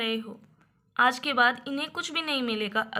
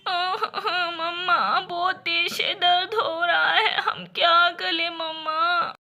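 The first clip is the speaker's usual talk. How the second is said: crying to mamma; 3 semitones higher, 5 dB louder, slower than usual. Acted. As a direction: sob it out to mother. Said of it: "आकर रोने लगती हैं".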